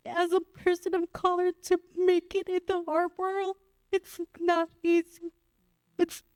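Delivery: fighting back tears while still speaking; choked up. Real, not acted. Panelist choking up about struggling as an artist of color, tearful. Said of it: "I get emotional all the time".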